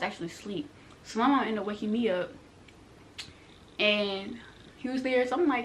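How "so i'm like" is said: usual speaking voice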